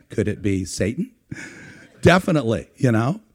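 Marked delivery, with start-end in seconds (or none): 0.1-1.1 low, softened sketch-comedy voice, swooping up at the end; mock-suspicious church lady catchphrase. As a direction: drop low, then lift the last word into a question. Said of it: "Mike Myers is there saying".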